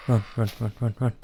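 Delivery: breathless frantic run run run; panicked urging. Real, not acted. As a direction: urge yourself on in panic. Fleeing a monster.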